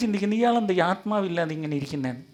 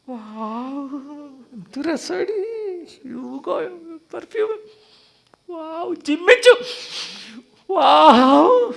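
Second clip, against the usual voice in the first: much higher, breathless gushing; swooning Eve; 10 semitones higher, 5 dB louder, much slower than usual. Acted. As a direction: swoon over the perfume. Eve swooning over the scent. (ഹവ്വ മണം ആസ്വദിച്ച് കൊഞ്ചുന്നു)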